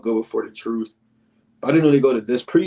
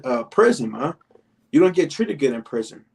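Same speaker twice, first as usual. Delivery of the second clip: ordinary delivery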